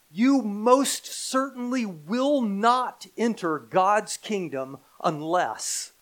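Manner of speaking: slow, loud, high stress on "most certainly"; very emphatic. Acted. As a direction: hammer out "will not" deliberately. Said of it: "Very emphatic".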